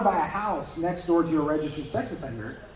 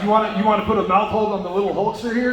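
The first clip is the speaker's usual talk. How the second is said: louder, fast hammed-up wrestler voice, laughing through it; hammed-up Hulk Hogan come-on, a bit louder and quick; bad Hulk Hogan impression; 5 dB louder, faster than usual. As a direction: pitch it like a cheesy pro wrestler, badly done. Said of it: "my really bad Hulk Hogan impersonation".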